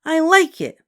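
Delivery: put-on high, loud stubborn child; stubbornly. A narrator's reading. Ramona answering her mother, stubborn and high. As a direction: dig in, refusing to budge. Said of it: "said Ramona stubbornly".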